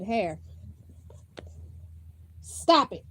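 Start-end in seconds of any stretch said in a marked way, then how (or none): 2.4-3.0 scolding. Real, not acted. Mother scolding child again.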